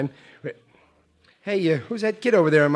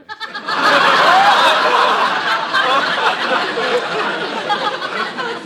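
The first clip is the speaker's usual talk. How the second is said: high, long howl of laughter; 3 dB louder than usual. Acted. Wife laughing at husband's crew cut. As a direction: laugh hard at him.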